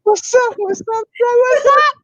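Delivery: high falsetto What's up, hammed up; squeaky greeting. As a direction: pipe it up high and loud.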